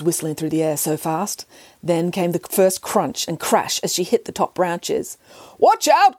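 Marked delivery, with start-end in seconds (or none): 5.6-6.2 sharp high warning shout. Acted. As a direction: yell a warning to the children. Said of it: "cried the foreman".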